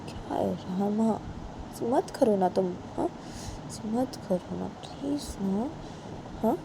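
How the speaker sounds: quiet drawn-out please; slow soft plea. Acted. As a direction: plead gently and slowly. Bani begging Rama.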